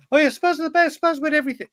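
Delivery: mock his younger self gushing over Spurs; a childish mock voice; imitating young fan. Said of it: "being like".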